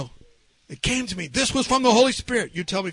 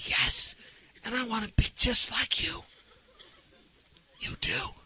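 soft, confiding exchange; whispering; 3 semitones higher, 9 dB quieter than usual. Retold or imitated. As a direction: keep it a gentle whisper. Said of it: "I started whispering".

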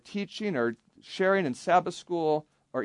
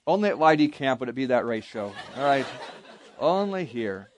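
ordinary delivery

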